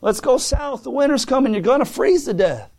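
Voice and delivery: high-pitched acted holler from overhead; hollering crows. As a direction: call out like crows cawing, pitch way up. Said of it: "Here comes the crows over hollering".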